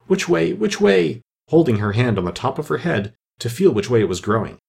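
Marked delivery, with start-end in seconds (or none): 0.1-1.2 rising, worried self-questioning; anxious girl checking herself. A narrator's reading. Alice anxiously asking herself which way she grows. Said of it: "said anxiously to herself".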